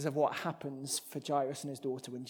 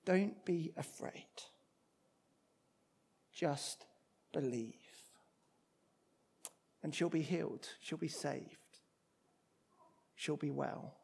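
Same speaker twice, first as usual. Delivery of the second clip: gentle reassurance; 6 dB quieter than usual. Retold or imitated. Jesus reassures a grieving father.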